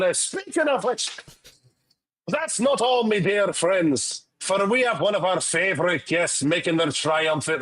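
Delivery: grand guest introduction in a Scottish-Irish brogue; Scottish-Irish accent. Shown as a demonstration. Host welcoming a favorite returning guest, in a Scottish-Irish accent. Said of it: "I did do a Scottish-Irish accent".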